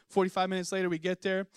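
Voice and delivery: ordinary talk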